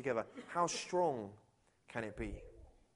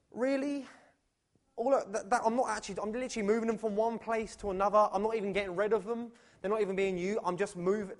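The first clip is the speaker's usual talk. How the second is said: higher-pitched, incredulous protest; exasperated; 3 semitones higher than usual. Acted. Worker given a pointless moving task, exasperated. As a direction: protest in disbelief, stumbling over the words.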